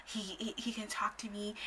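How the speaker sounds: slow imitated whisper. Friend saying he won't leave, whispering. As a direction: whisper it, drawing the words out. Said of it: "she's, like, whispering".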